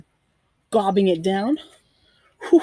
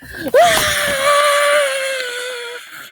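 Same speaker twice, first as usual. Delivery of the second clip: a long, much higher wordless yell; crazy scare shout; 4 dB louder than usual. Pretend. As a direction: go wild with a drawn-out scream.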